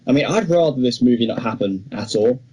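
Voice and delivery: ordinary talk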